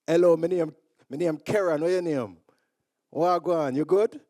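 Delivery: put-on young self introducing himself in broad Jamaican; thick Jamaican accent. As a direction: greet them in heavy Jamaican patois. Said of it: "I had this very thick Jamaican accent".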